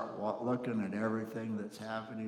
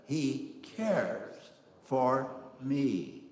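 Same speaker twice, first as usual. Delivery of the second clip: higher and louder, "cares" lifted high; rising third affirmation; 5 semitones higher, 5 dB louder, much slower than usual. Shown as a demonstration. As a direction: build it up on the third time. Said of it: "A third time".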